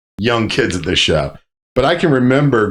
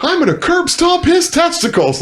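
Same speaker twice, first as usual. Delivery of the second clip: high, worked-up angry protest; mock-furious rant, high-pitched; retold fury. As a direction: act out losing your temper, pitched way up. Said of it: "on a whole nother anger level".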